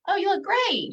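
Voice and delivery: high-pitched, imitated friendly gush; gushing compliment. Woman complimenting a friend on how she looks, gushing.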